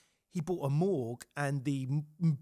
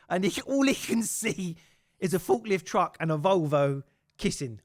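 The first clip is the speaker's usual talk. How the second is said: normal talking voice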